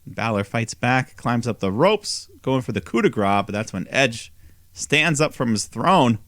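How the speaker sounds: normal talking voice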